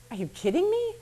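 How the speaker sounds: high-pitched retold disbelief; incredulous. Replying to a woman who wants Scientology taken off, incredulous. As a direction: toss it back, high and incredulous.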